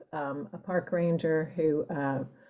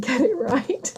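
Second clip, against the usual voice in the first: laughing; 8 semitones higher, 3 dB louder than usual. Real, not acted. Laughs at copy editing ordeal.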